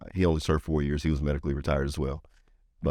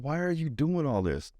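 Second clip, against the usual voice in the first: tearful question; 10 semitones higher than usual. Retold or imitated. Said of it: "he just breaks down crying".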